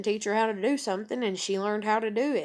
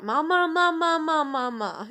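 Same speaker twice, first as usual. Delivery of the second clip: put-on high fussy toddler calling mama; irritated baby; 7 semitones higher, 4 dB louder than usual. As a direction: imitate a cranky baby calling, high then fading. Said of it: "when she'd get kinda irritated".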